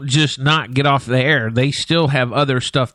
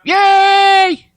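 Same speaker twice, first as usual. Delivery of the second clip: high, loud happy squeal; excited cheer; 6 dB louder than usual. Real, not acted. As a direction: cheer it out with delight. Cheering at a posted picture.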